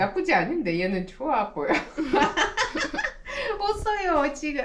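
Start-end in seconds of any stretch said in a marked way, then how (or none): 3.6-4.6 high-pitched laugh running into the words; laughing hard. Real, not acted. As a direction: squeeze the words out through laughter. Dog seems to be smiling. (높은 소리로 깔깔 웃으며)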